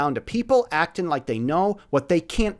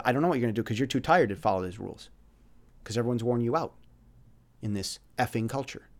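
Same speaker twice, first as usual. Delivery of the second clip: low, soft, sympathetic reply; very gentle; 5 semitones lower, 8 dB quieter than usual. Retold or imitated. Mentor answering a worn-out kid, very gently. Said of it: "I said very gently".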